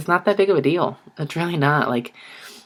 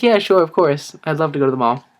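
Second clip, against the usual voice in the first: fake enthusiasm; 4 dB louder than usual. He accepts the cousin's mall invitation he dreads, with fake eagerness. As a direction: sell a cheerful yes you don't mean. After "here we go", faked eager reply.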